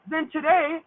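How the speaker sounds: ordinary talk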